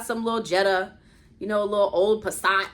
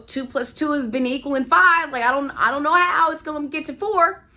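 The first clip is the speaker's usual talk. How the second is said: 1 exasperated plea; 5 semitones higher, 5 dB louder than usual. Retold or imitated. Pleading prayer to God, retold.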